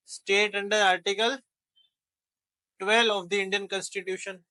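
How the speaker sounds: very slow, each word spaced out; slow teacher dictation to students. Shown as a demonstration. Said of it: "teacher dictating slowly".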